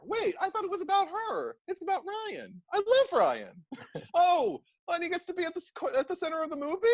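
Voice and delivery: high, breathless gushing; gushing fan. Retold or imitated. Fan swooning over Ryan at the movie, high and gushing. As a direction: gush like a smitten fan.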